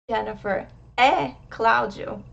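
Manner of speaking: é as an open, emphasized eh between the names; accented é. Shown as a demonstration. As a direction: punch é as a loud open eh. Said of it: "If I say".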